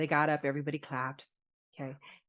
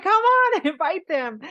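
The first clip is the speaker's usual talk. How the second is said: high loud laughing plea, much higher and louder; playful insistence, pitched way up; excited pleading; 12 semitones higher, 10 dB louder than usual. Retold or imitated. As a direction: beg him through a laugh. Pushing him to invite friends.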